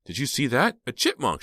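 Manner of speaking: high and bright with excitement; excited exclaim. A narrator's reading. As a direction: point it out, thrilled. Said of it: "she exclaimed".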